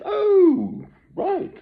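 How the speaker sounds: high squeaky mock official; mock police reply. Imagined officer at his door answers, squeaky and mocking. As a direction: pitch way up, a sarcastic official.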